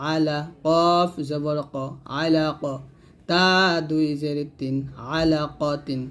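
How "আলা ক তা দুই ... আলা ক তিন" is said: ordinary talk, nothing marked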